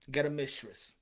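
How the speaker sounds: usual speaking voice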